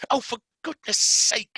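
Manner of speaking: high, loud exasperated snap; Mary fed up with the screaming; snapping. Acted. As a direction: snap in annoyance. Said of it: "she snapped".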